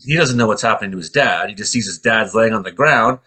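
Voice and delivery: usual speaking voice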